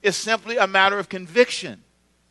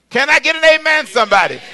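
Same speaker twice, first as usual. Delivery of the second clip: high, loud pulpit call-out; calling for amen; 6 semitones higher, 5 dB louder than usual. Real, not acted. Preacher asks congregation for an amen, loud call. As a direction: throw it out to the whole room.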